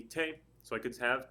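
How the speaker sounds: ordinary delivery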